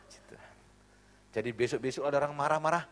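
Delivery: ordinary talk, nothing marked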